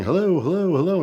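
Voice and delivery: loud sing-song calling into a barn; hollering hello, high and loud. Retold or imitated. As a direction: call out like nobody's home. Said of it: "just yelling".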